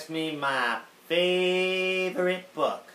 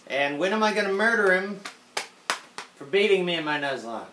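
louder, higher mock threat; mock menace; 5 semitones higher, 4 dB louder than usual. Teasing rival, vowing revenge, mock loud. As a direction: ham up a vow of revenge.

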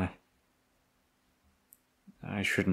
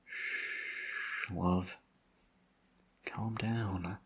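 hushed soothing; 9 dB quieter, much slower than usual. Acted. Soothing injured partner awaiting ambulance.

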